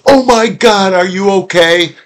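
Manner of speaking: high-pitched, panicked parental concern; acting out a parent's alarm over a fallen child. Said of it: "if the parent goes".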